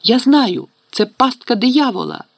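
high, alarmed realization; urgent warning. A narrator's reading. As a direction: blurt out the discovery urgently. Girl urgently warns trapped friends.

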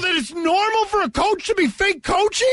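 high, disbelieving question; can't believe what he's hearing; incredulous. Real, not acted. Incredulous at being told take the L.

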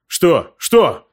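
sudden rising startled questions; jolted awake by hope. A narrator's reading. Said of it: "встрепенулся строитель".

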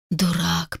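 soft self-reproach under breath; inner thought. A narrator's reading. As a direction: mutter it to himself. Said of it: "подумал он".

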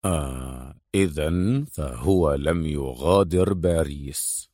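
low, soft, slow musing; frowning hero. A narrator's reading. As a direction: think it through slowly, deep and quiet. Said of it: "قطب أدهم حاجبيه وقال".

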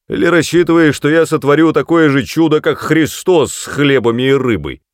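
high, loud sarcastic shout; angry shouting. Acted. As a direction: roar the sneer at her. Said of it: "заорал он на неё".

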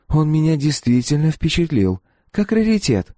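put-on high-pitched young woman's reply; candid girl. A narrator's reading. Girl to the mercenary about his antique droid, candid.

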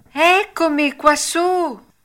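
high, loud little call from inside; a tiny voice answering the knock. A narrator's reading. The cricket calling from inside the hut, tiny voice.

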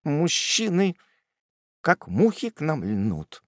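usual speaking voice

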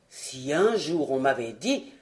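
voix grave de colosse; exclamation d'un géant bonhomme, bien plus grave; colosse qui s'écrie. Acted. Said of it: "s'écria le colosse". (voix du colosse, grave et étonnée)

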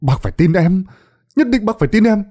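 high, urgent insisting voice, begging to be believed; pleading young villager. Acted. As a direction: swear it's true, voice climbing high. Đồng insists he saw the god. (giọng cầu xin, cao và gấp gáp)

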